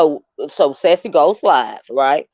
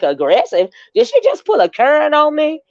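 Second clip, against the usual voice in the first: incredulous retort; 10 semitones higher than usual. Retold or imitated. Retelling her shocked reaction.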